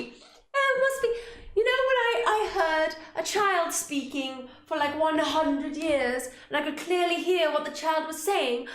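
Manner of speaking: mock the ghost excuse incredulously; mocking squeak. Player mocking the wind excuse in a high slow voice.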